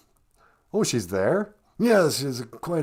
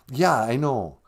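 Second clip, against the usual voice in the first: usual speaking voice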